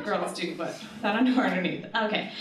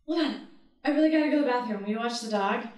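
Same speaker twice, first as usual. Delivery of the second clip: urgent rush; much faster than usual. Retold or imitated. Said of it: "So I'm like".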